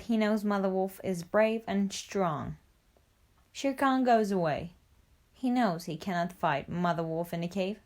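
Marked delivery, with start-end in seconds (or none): none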